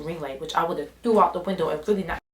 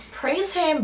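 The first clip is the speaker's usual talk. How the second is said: churchy praise; 9 semitones higher than usual. Pretend. Parked at church.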